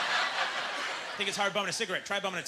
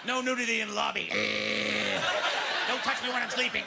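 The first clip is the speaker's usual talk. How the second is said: satanic landlord; 4 semitones higher, slower than usual. Retold or imitated. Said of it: "he says satanic stuff like".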